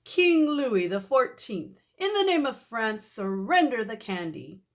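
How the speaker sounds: hammed-up king of France; royal decree. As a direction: proclaim like a pompous king. Principal plays French king.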